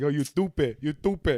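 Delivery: "you stupid" with a mocking lisp; imitating a lisp. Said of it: "I don't talk like that".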